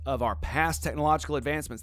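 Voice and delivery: ordinary delivery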